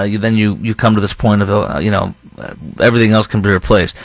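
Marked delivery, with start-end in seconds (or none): none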